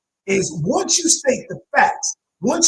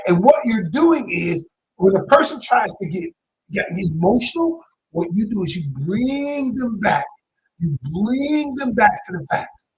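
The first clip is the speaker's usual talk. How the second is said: ordinary talk, nothing marked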